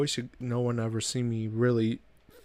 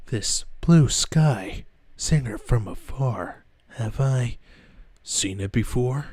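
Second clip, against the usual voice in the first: slow, loud brooding edgelord; edgelord shadow voice; 6 dB louder than usual. Retold or imitated. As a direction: drag it out like a moody antihero. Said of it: "a shadow voice, an, an edgelord".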